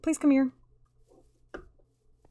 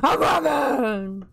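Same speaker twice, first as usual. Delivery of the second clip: slow, rough superhero growl; Batman; slower than usual. Pretend. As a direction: growl it long, as the caped hero. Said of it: "If you guys get that reference".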